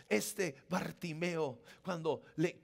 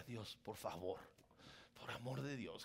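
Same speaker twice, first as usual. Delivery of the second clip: exasperated aside; 9 semitones lower, 8 dB quieter than usual. Retold or imitated. Preacher retells own exasperated aside.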